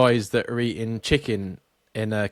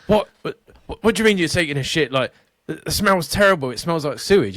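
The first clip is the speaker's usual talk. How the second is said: higher, flustered mock outrage; indignant Karen; 6 semitones higher, 4 dB louder, faster than usual. Karen questioning the man in the bathroom, indignant. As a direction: splutter like a scandalised Karen. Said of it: "of the Karen that's knocking".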